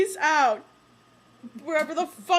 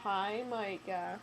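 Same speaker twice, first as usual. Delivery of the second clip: soft slow greeting; 7 semitones lower, 10 dB quieter, much slower than usual. Real, not acted. Greeting the cat slowly.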